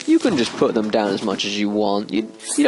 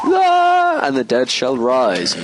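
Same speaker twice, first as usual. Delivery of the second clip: mock shriek; 2 semitones higher, 3 dB louder, slower than usual.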